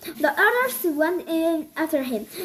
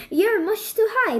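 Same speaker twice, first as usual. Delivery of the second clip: calling out; 6 semitones higher, 3 dB louder than usual. A narrator's reading. Mother calling to flying boys.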